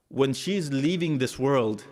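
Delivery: ordinary talk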